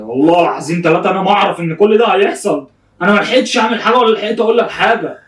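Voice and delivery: worked-up, emphatic self-defence; pleading protest. Real, not acted. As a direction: plead your innocence with rising pitch. Protesting innocence to crying friend.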